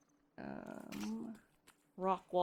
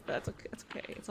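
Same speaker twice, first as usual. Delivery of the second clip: whispered; 9 dB quieter, much faster than usual. Real, not acted. Resigned while dying.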